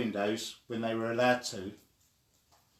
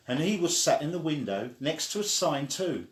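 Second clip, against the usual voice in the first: plain reading voice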